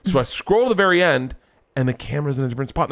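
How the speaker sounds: ordinary talk, nothing marked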